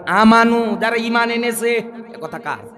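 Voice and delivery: ordinary talk, nothing marked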